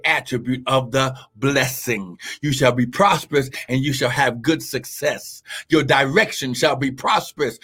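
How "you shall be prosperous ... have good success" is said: ordinary talk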